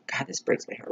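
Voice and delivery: soft breathy whisper, heartbroken; hushed, heartbroken whisper; whispered heartbreak. Real, not acted. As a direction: barely whisper it, moved. Heartbroken over a TV scene.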